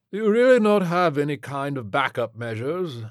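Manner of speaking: high, pompous question starting loud, fading; rich man growing fainter. A narrator's reading. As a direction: plead as your strength goes. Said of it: "Flintlock said, his voice growing fainter".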